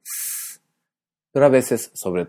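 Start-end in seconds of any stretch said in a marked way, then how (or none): 0.0-0.6 S as a soft hiss without voice; voiceless S. Shown as a demonstration. Teacher demonstrates the usual Spanish S, voiceless. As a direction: sustain a soundless-throated "sss". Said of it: "un sonido sordo".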